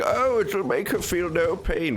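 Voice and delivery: high, slow, sugar-coated imitation; mock nice. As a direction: mimic him making it sound all nice. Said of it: "he was making it sound all nice".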